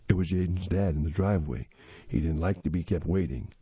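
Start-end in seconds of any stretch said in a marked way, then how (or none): none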